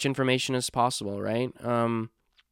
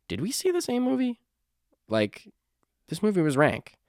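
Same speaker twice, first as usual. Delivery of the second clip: much higher, squeaky disbelief; incredulous disbelief; 8 semitones higher than usual. Retold or imitated. To the reviewers, baffled and mocking in disbelief. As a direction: ask it like you can't believe your ears.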